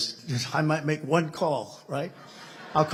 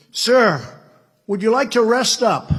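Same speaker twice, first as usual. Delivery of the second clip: higher and louder offer; loud solicitous question; 7 semitones higher, 9 dB louder than usual. Retold or imitated. General greeting the president off the plane, loud eager. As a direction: call it out like an eager officer.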